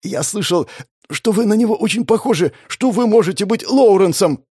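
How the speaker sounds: high-pitched, anxious report; fearful confession. Acted. Captive telling his torturer a dangerous rumour, fearful.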